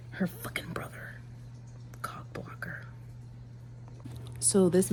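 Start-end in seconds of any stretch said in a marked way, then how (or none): 0.1-2.9 bitter hushed whisper. Real, not acted. Reader whispering annoyance at the brother. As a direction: grumble it under your breath.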